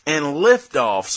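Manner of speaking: ordinary talk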